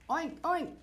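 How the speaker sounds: high playful pig grunts; pig oink. A narrator's reading. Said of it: "grunted the pig".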